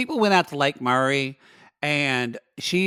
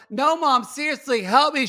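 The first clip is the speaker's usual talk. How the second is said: mock cry for help; 10 semitones higher, 3 dB louder than usual. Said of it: "they go".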